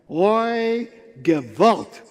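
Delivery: high drawn-out oi, then loud GEWALT. Shown as a demonstration. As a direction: cry out the lament at full voice. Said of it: "You gotta say the Gewalt loudly".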